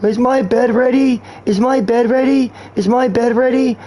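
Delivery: high-pitched, repetitive nut voice; imitating a nut. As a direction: play a pestering nut, pitched well up. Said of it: "sitting next to a nut saying".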